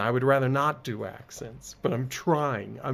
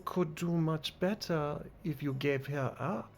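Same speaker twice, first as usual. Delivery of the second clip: put-on haughty, lilting French accent. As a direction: play a condescending French harlequin. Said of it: "this game wants me to do accents".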